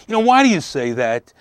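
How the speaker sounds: loud, high-pitched exasperated challenge, acted; irritated husband. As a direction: imitate a husband snapping at his wife. Voicing client snapping at his wife.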